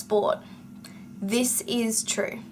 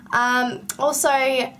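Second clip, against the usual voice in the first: ordinary talk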